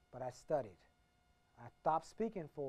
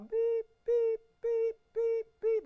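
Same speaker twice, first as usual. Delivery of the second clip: high, loud electronic beeps; high beeping noise while backing away from negative people; mock beeping; 9 dB louder, much slower than usual. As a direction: beep like an alarm going off. Mimics a warning beeper.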